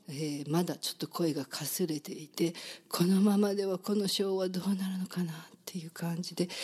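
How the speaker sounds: real hoarse voice, half whispered. Real, not acted. Host apologizing on air for her hoarse voice, whispering. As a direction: voice worn out by a cold, barely voiced.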